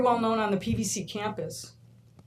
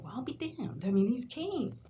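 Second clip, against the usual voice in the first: soft, higher mild amazement; imitating the bread line guy; 3 semitones higher, 4 dB quieter than usual. Teacher quoting the man at the bread line, in soft amazement. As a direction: echo the man's astonished words. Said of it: "kinda like the guy at the bread line".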